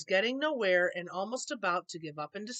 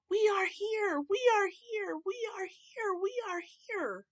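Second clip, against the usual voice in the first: tiny crowd cry; 9 semitones higher than usual. A narrator's reading. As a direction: cry out to be heard, then fade. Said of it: "They cried out in fear".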